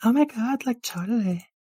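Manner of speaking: put-on high, excited girly voice; volunteer in a high girlish gush; high-pitched eager girl; gushing valley girl, eager.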